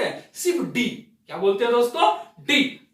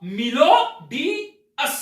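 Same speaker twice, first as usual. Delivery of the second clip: slow, stressed; 2 semitones higher, much slower than usual. Shown as a demonstration. Teacher drawing out the trick phrase for viewers. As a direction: drag out the pun for effect. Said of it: "क्या हो गया दोस्तों?".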